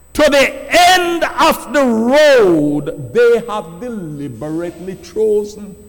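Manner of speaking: preaching at full force. Acted. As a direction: thunder the warning to the congregation.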